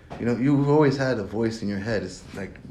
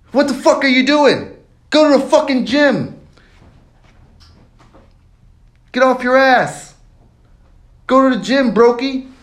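screamed, much higher and louder drill-sergeant bark; Andrew Tate shouting; 11 dB louder than usual. Retold or imitated. Andrew Tate screaming at a lazy listener to hit the gym. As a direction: yell at a lazy listener to get up. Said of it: "he is the voice".

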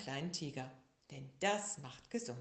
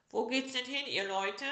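higher, louder friendly call; elephant greeting; 7 semitones higher, 6 dB louder than usual. A narrator's reading. Big elephant hailing the travellers, cheerful call. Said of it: "fragte er".

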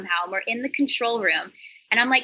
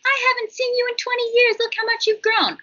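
much higher, louder doting family friend; gushing parent; 13 semitones higher, 4 dB louder than usual. Retold or imitated. Family friend greeting a grown-up kid, gushing and high. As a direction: coo like an old friend of the family. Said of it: "when parents are like".

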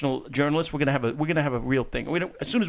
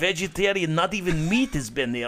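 hammed-up Russian guy accent, held for the bit. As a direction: keep the joking Russian voice going. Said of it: "that is a good accent".

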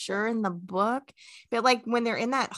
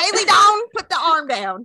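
playful scold; 8 semitones higher, 9 dB louder than usual. Real, not acted. Telling friend to lower hand.

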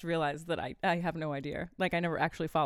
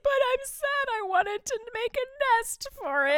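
put-on kid voice; 4 dB louder than usual. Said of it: "she was like".

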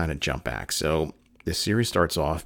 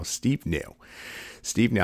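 real catch of emotion, second phrase lifting; getting emotional as you repeat it; choked up; 6 semitones higher, slower than usual. Real, not acted. Fan recalling Steve's faith in Sam, choked up. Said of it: "I'm already getting choked up".

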